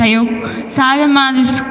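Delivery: ordinary talk, nothing marked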